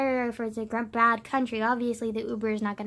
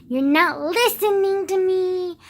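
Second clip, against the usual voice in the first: slow, higher, louder nagging mom; mock mom, slow and loud; 6 semitones higher, 7 dB louder, slower than usual. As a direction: drag it out like an exasperated mother. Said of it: "being like".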